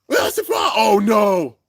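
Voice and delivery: high, loud shout of dismay; shocked, high-pitched exclamation; alarmed cry. Real, not acted. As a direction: cry out high as disaster hits. Reacting in alarm to the battle.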